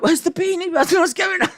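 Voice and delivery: high squeaky mock alarm; mock outraged viewers. Fans asking where the beanie went, high mock alarm. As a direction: squeak it like a panicked fan.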